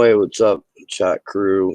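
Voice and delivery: ordinary talk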